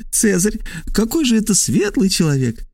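high, amused character voice; laughing. A narrator's reading. Trasul laughs with the stranger about Caesar, high amused voice. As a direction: begin with a short acted laugh, speak high. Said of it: "Трасул тоже рассмеялся".

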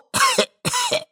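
acted high wheezy coughing fit; staged repeated coughing; old man's cough. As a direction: cough like a doddering old man.

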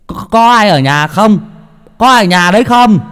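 loud, high call toward the house. Acted. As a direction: shout to whoever is inside. Calling out at the gate. (gọi to, cao giọng vào trong nhà)

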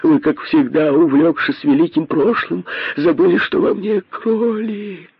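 high-pitched entreaty, climbing at the end; pleading. A narrator's reading. Косой reminds the king of the rabbit, pleading. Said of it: "взмолился Косой".

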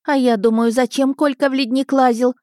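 put-on fretful housewife; lamenting woman. A narrator's reading. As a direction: carry on moaning indignantly. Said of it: "снова запричитала женщина".

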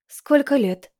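lightly voiced polite woman; Mori's voice. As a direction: greet him politely. Mori greets him.

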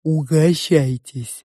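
slow, cooing sow's voice; cooing pig. A narrator's reading. The pig offers food to the guests, cooing. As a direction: coo it slowly, drawing out each vowel. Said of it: "ворковала свинья".

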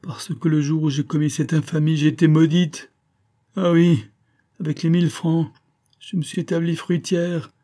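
dying woman, bitterly remorseful; anguished dying woman. A narrator's reading.

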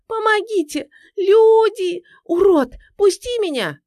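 very high screams for help; frantic squealing. A narrator's reading. Woman calling for help against attacker, frantic shrieks. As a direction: shriek desperately to be freed. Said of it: "истошно визжала женщина".

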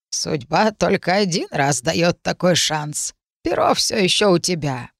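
slow creaky crone rasp; thin reedy voice. Acted. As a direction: creak it out like an old witch. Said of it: "проскрипела".

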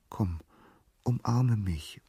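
soft, high, enticing; gentle lovestruck plea; tender coaxing. A narrator's reading. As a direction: invite him sweetly, smitten. Nymph coaxes Narcissus tenderly.

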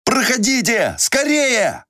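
commanding. Acted. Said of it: "скомандовал он".

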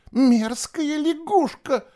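high, slow, drawn-out insult; disgusted girl. A narrator's reading. Girl wiping burning slime off her face, disgusted. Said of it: "бормотала девочка".

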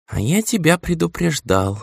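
ordinary delivery, nothing marked

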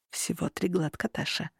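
very soft, hushed male murmur; hoarse whisper. A narrator's reading. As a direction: whisper it intimately, voice fading on the name. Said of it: "ответил он шёпотом, хриплым и взволнованным".

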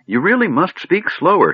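high, exasperated demand; scolding. A narrator's reading. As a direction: scold them for talking too fast. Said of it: "shaking his staff at the gnome".

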